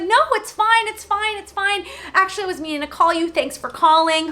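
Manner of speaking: high, friendly phone voice; super-nice receptionist. Retold or imitated. Receptionist thanking the caller, high reassuring voice.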